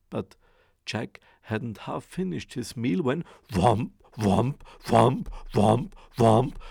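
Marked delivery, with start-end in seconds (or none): 3.5-6.6 louder on each whomp; loud booming footsteps. A narrator's reading. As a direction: stomp out the giant's steps. Ogre's footsteps shake the house.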